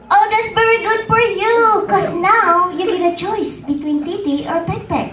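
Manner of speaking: a hint of a cheerful mother teasing; Filipino mom. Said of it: "And then she says".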